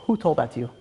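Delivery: low, soft, grave question; very serious. Retold or imitated. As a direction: act out a king turning serious. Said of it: "gets very serious".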